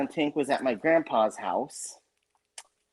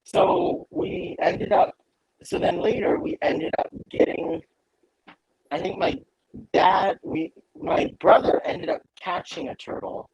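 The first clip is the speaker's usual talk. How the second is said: glitchy robotic audio; robot voice through a broken mic; 4 semitones higher, 3 dB louder than usual. Real, not acted. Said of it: "Your audio is jacked".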